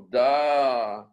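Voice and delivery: "da" said slow and heavy; insisting. Shown as a demonstration. Teacher stressing the doubled letter, emphatic. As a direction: lean on "da" slowly. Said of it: "un point pour insister".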